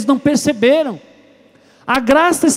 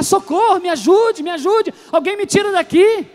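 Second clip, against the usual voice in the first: raised, higher cries for help; calling for help; 7 semitones higher than usual. Acted. Gravedigger stuck in a grave yelling for rescue. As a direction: shout up from the bottom of a pit. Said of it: "E começou a gritar".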